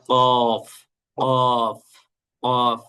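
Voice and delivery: কফ said thick and heavy from the throat; thick qaf sound. Shown as a demonstration. Teacher repeating the heavy qaf after explaining it. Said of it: "মোটা করে উচ্চারণ করতে হবে".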